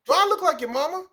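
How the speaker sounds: high, loud disbelief; incredulous retort. Retold or imitated. Teacher to a kid handing her his folder, high incredulous. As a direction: hit it high in mock outrage.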